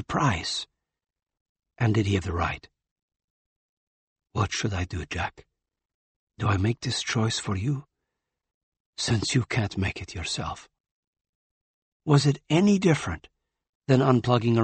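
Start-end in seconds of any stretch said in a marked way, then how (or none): 4.3-10.7 quiet inner voice. Acted. Doctor musing over dying patient.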